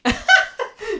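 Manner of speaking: loud high laugh bursting out; burst of laughter. Real, not acted. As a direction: crack up at the mix-up. Laughing at dog clip.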